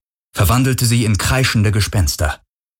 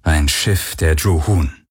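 ordinary delivery, nothing marked

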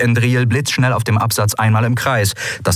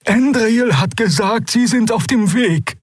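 trembling voice; 14 semitones higher than usual. A narrator's reading. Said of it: "mit bebender Stimme".

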